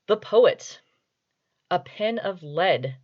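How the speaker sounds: very serious reading. Shown as a demonstration. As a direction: read a child's rhyme as if it were grand poetry. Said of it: "I'm going to do this as a very serious reading".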